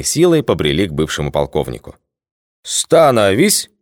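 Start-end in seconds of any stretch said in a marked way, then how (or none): 2.6-3.7 slow, drawn-out parade-ground shout; barked command. A narrator's reading. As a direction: call the squad to fall in. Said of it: "скомандовал Анатолич".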